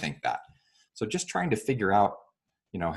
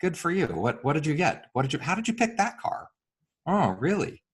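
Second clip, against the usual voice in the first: interested acknowledgement; 8 semitones higher than usual. Shown as a demonstration. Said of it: "Instead, I wanna say".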